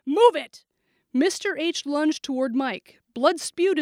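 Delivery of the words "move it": higher, louder snapped command; angry order. A narrator's reading. Shooter ordering the teacher aside, angry. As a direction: bark it impatiently.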